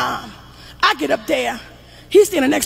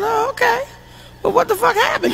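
pitched way up in shocked disbelief; high squeaky disbelief; 8 semitones higher, 3 dB quieter than usual. Retold or imitated. Mother shrieking high at her son's calm confession. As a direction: squeal it, half crying.

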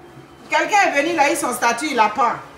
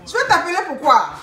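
indignation moqueuse; 5 semitones higher than usual. Retold or imitated. Répond à la femme de Laval.

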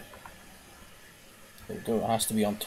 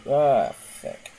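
ordinary talk

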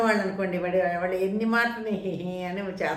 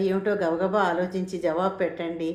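ordinary delivery

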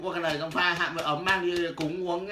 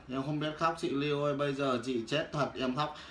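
ordinary talk

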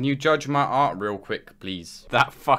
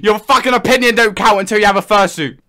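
angry shouting; 6 semitones higher, 8 dB louder than usual. Pretend. Yelling at caller.

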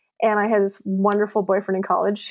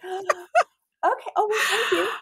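laughing; 12 semitones higher, slower than usual. Retold or imitated. Flustered reaction to misheard compliment.

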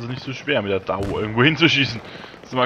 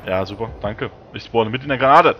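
ordinary delivery

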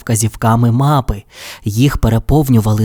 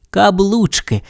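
ordinary delivery

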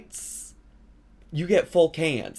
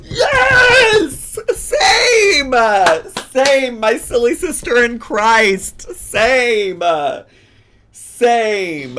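loud, high, drawn-out yells of agreement; excited shouting; 11 semitones higher, 10 dB louder, much slower than usual. Real, not acted. Host cheering on her silly sister in Christ, excited shouting. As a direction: shriek it in delighted solidarity. Gleefully agreeing with the video creator.

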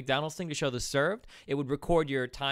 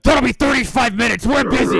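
loud, high mock cook shout; barking cook; 11 semitones higher, 14 dB louder than usual. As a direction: bark it out like a swamped cook. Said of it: "And the cook's like".

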